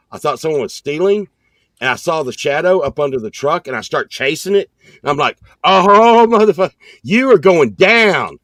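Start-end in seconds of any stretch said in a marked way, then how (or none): 5.6-8.4 loud, raised, blustering challenge; retold threat. Chaser to a supposed thief, loud mock threat. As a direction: call it out in hot pursuit.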